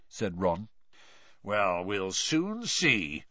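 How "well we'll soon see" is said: growling Mister Diggory. A narrator's reading. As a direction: growl it slowly, unimpressed. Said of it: "growled Mister Diggory".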